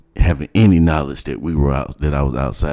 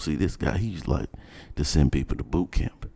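ordinary talk

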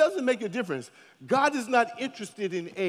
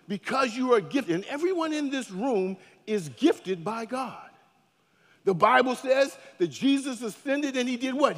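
normal talking voice

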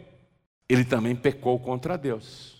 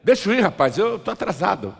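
raised, higher plea to get away; insistent brush-off; 3 semitones higher than usual. Acted. Passerby pressed by a beggar, insistent brush-off. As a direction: push past with a firmer, higher voice.

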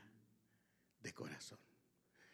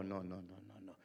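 ordinary delivery

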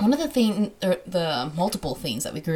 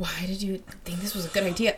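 teasing whisper; 6 dB quieter than usual. Real, not acted. Teasing partner in pain.

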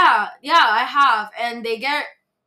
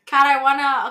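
usual speaking voice

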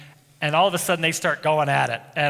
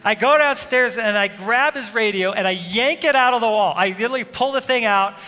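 louder, higher heated retelling of the outburst; retold anger; 3 semitones higher, 4 dB louder than usual. Pastor retelling yanking his son's radio, heated and loud. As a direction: relive the angry rush, pushing the volume up. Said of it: "I was so angry".

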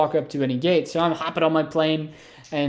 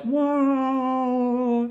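high, drawn-out falling "whoa"; Mario death wail; 9 semitones higher than usual. Retold or imitated. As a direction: wail like a video-game character dying. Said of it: "that's the Mario death sound".

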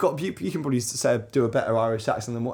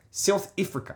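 exaggerated South African accent, higher pitched; 5 semitones higher, 4 dB quieter than usual. Shown as a demonstration. Demonstrating accents to his co-host, overdone South African accent. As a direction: put on South African vowels, "Ifrica". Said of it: "South Africa is like South If".

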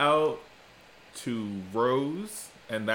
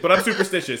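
usual speaking voice

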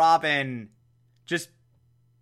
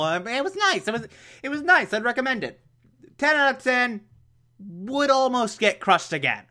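breezy hero; 8 semitones higher than usual. Retold or imitated. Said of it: "we had the air pumps".

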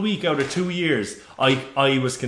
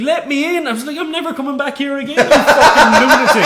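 high-pitched mock outrage, half laughing; incredulous retort breaking into laughter; retold indignant outburst; 11 semitones higher, 9 dB louder than usual. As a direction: build to a loud, laughing insult.

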